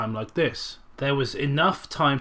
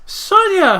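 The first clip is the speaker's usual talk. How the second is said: much higher, loud cry of a name; alarmed cry; 8 dB louder than usual. Acted. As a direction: cry out her name, caught. Mother caught out.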